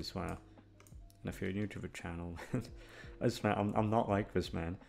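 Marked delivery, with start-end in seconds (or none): none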